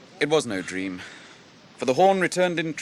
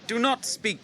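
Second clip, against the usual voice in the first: stern command; 11 semitones higher, 5 dB louder, slower than usual. Acted. Captain cuts off angry servant.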